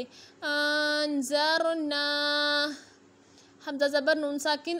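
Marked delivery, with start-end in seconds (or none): 0.0-2.8 अन ज़र ना with the ना held one alif long; slow recitation, अन ज़र ना with a long ना; drawn-out vowel. Shown as a demonstration. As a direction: stretch the final ना and blend in a nasal इख्फा. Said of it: "एक अलिफ की मिकदार तक खींच कर पढ़ेंगे".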